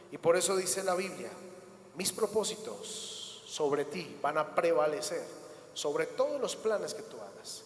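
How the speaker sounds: usual speaking voice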